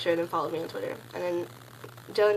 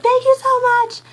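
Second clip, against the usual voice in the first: much higher, louder thrilled thank you; ecstatic thanks; 11 dB louder, slower than usual. Retold or imitated. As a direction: gush thanks at the top of your voice. Thanking him after getting ticket.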